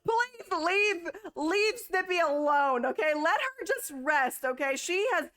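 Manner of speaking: high, slow pleading cry; mock pleading. Real, not acted. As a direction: beg them, with a crying edge. Pleading for the dead horse.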